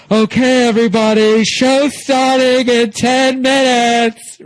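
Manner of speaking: loud, high, slow sing-song announcement; mock announcer. Comedian mimicking the drag host announcing the show, loud sing-song. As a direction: belt it like a drag host on the mic. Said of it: "He's like".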